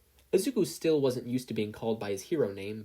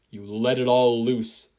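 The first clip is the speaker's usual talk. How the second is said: slow, much louder, stretched out; big loud release; 9 dB louder, slower than usual. Acted. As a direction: let it all out like a battle cry. Climax of lesson.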